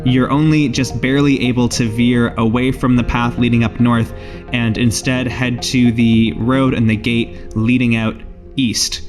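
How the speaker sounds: ordinary delivery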